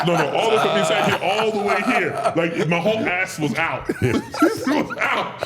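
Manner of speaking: loud, high, emphatic and laughing; laughing insistence. Real, not acted. Wrestler insisting how far his pants ripped, loud laughing insistence. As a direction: belt it out through laughter.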